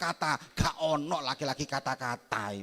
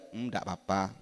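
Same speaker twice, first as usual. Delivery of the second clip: indifferent dad; 7 semitones lower, 7 dB quieter than usual. Retold or imitated. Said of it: "bapaknya cuma lihat".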